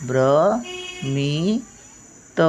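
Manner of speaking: भ्रमित drawn out very slowly and softly; very slow demonstration. Shown as a demonstration. Teacher modelling भ्रमित to pupils, very slow and soft. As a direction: stretch भ्रमित for the class. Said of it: "भचा पहिला येतो उच्चार".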